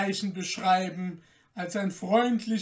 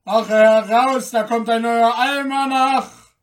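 loud alarm call, higher; guard's loud raised alarm; 4 semitones higher, 12 dB louder than usual. A narrator's reading. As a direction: shout the watch out of the guardhouse. Gate guard calls out the watch.

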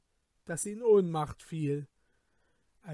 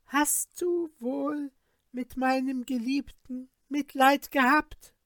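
sorceress voice; 8 semitones higher than usual. A narrator's reading. The sorceress mocks her cousin.

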